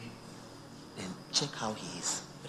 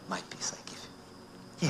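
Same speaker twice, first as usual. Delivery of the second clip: whispered God's voice; 6 dB quieter, faster than usual. Acted. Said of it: "because he says".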